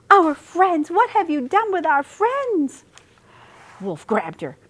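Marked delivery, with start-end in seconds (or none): 0.1-2.8 high, loud alarmed cry; shocked outrage. A narrator's reading. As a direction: shout it in horror. Dog finds the broken china.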